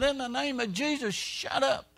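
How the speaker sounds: retold angry order to a demon; mock angry command. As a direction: pitch jumps way up as he casts it out. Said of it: "Brother Hagin got mad".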